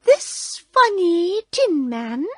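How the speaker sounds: high, slow squeaky queen voice; mouse queen. A narrator's reading. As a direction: squeak regally to your subjects. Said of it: "she answered".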